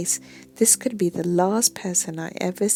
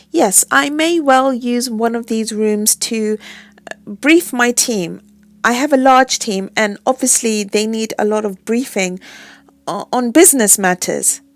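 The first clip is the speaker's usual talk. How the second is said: fake confident; 3 semitones higher, 8 dB louder than usual. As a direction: bluff like a big executive. Faking executive confidence.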